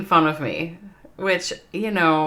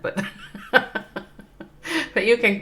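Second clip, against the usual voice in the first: slow, laughing through the words; 5 semitones higher, much slower than usual. Real, not acted. Inviting a chatter to stay while laughing at himself. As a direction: giggle as you offer it.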